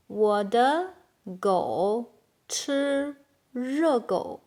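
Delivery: slow, with gaps between words. Shown as a demonstration. Teacher translating "my dog eats hot dog", slow word by word. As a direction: draw out each word for learners. Slow model, then natural take.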